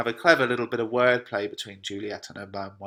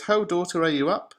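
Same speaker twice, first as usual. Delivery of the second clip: raised, higher-pitched call; 7 semitones higher, 6 dB louder than usual. Acted. Lady Capulet calls to her daughter, calling out. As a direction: call up to your daughter, louder.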